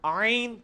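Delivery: آئین clearly drawn from the throat; sustained mid-throat ع in آئین; corrective ain demo. Shown as a demonstration. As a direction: correct the student with a held آئین. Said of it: "نہ جی، نہ".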